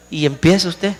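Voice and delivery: ordinary talk, nothing marked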